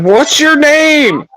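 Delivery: stretched-out high silly question; high and slow. Shown as a demonstration. Repeating the question to Josh, high and slow. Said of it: "I said".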